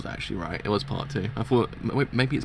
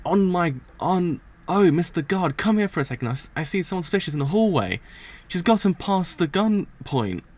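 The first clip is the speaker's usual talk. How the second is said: high, urgent acted call; alarmed character voice, pitched high; calling a guard; 6 semitones higher than usual.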